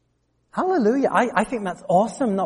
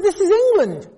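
baffled priest; 9 semitones higher than usual. Retold or imitated. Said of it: "it was like it didn't compute".

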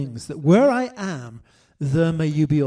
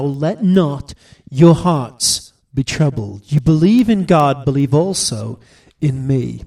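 solemn scripture reading; 3 semitones lower, 6 dB louder than usual. Acted. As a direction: proclaim each line to the congregation. Preacher reads John fourteen aloud.

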